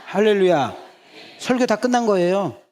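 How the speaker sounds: high, loud hallelujah shout; preaching at full force. Real, not acted. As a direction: lift the congregation with a triumphant cry. Preacher climax after congregation line.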